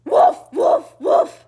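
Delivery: loud high woofs of a charging dog; loud barking. Acted. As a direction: bark it out big and high.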